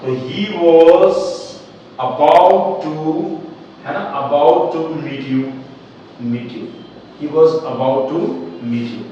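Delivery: very slow, drawn-out dictation; patient teacher spelling it out; slow model sentence. Shown as a demonstration. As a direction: stretch each word for the class. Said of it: "वह तुमसे मिलने ही वाला था".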